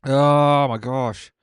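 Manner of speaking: long slow pained moan; drawn-out groan. Real, not acted. Suffering through hot wings, a drawn-out groan. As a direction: stretch it out like the heat won't quit.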